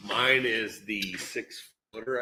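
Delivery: ordinary talk, nothing marked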